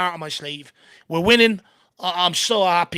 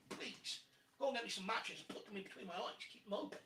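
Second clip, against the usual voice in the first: far from the mic; 11 semitones lower, 5 dB quieter than usual. Real, not acted. Fetching a drink, away from mic.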